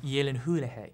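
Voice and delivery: "Gyllenhaal" as "yellen-hoo-leh-hey"; demonstrated pronunciation. Shown as a demonstration. Actor teaching his surname, "Gyllenhaal" as "yellen-hoo-leh-hey". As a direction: say "Gyllenhaal" with a y start and extra syllables. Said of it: "It's pronounced Gyllenhaal".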